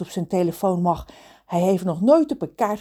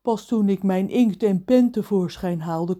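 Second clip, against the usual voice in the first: ordinary delivery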